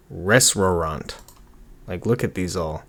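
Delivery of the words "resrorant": "Restaurant" said slowly as "ress-ROE-ront"; slow, deliberate "Restaurant" with r in place of t: ress-roe-ront; sounding out misspelling. Shown as a demonstration. Sounds out misspelled search terms.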